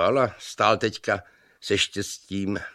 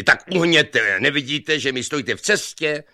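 loud, high irritated shout; hollering; 7 semitones higher, 5 dB louder than usual. A narrator's reading. Long-haired young man orders the other aside, hollering. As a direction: holler across rushing water. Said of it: "museli na sebe pěkně hulákat".

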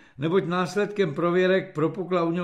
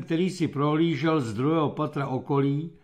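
plain reading voice

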